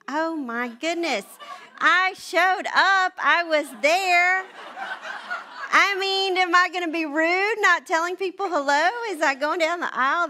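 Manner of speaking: high, loud, hammed-up protest; mock indignation. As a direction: defend yourself to the husband in comic outrage. Retold indignant defence to husband.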